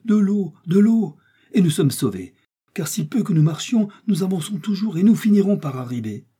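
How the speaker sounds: high, excited cry of hope; loud, elated opening, then rallying the others; joyful relief. Acted. Joy at finding water in desert.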